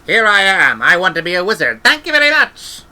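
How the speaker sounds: high, loud mock-proud child's voice; mocking a cocky child. Granny mocking Esk's cocky entrance, high and loud. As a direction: mimic a pompous little would-be wizard. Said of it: "waving your staff".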